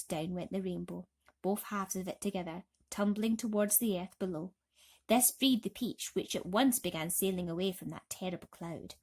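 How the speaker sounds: usual speaking voice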